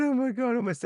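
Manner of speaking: very high, sarcastic gasp of alarm; sarcastic son pretending to be horrified; mock horror. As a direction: fake shock at a trivial amount. Mock horror at tiny snowfall.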